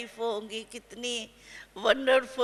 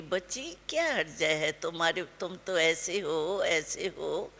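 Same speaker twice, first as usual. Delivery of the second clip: put-on lower, softer, tender coaxing of a child; spoken lovingly; 7 semitones lower, 4 dB quieter than usual. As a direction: gently praise her like a loving mother. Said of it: "प्यार से बोला".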